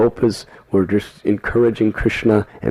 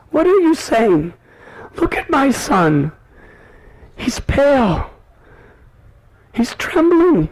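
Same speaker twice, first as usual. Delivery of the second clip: distressed mother; 11 semitones higher than usual. Acted. Yashoda rebukes Madhu Mangal, high anguished voice. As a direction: plead over her trembling son, high and upset. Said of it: "She said".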